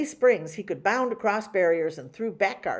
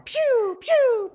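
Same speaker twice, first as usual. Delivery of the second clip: very high pew pew; laser gun noise; 6 dB louder than usual. Acted. Boy shoots his toy laser at the sunflower, very high pew.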